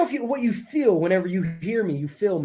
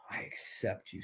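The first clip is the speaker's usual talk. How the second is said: very low and soft; hushed mantra; 10 semitones lower, 17 dB quieter than usual. Shown as a demonstration. Coach modelling the words for the group, hushed. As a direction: murmur it almost under the breath.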